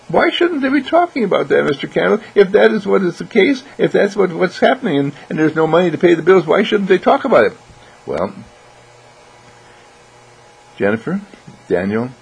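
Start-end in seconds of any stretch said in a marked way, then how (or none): 0.1-7.5 put-on high, loud, insistent child voice; protesting child. Child challenges Mister Candle about money talk, protesting. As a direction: question it eagerly as a puzzled kid, gathering speed.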